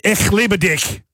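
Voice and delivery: high, forceful mock-German declaration; harsh German. Shown as a demonstration. Host showing German sounds odd for love, harshly. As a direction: spit it out like a comic threat.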